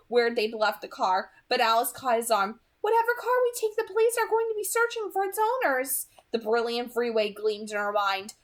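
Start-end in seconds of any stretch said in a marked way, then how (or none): 2.8-6.1 high, quick warning voice; Alice's voice. A narrator's reading. Alice stopping Jasper at the car, high and urgent.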